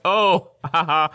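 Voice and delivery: high, loud, slow laughing imitation of Elon brushing it off; awkward laugh, drawn out and loud. Imitating Elon laughing off the interviewer's question, loud and slow. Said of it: "And then Elon's like".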